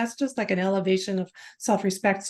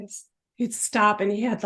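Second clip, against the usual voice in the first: usual speaking voice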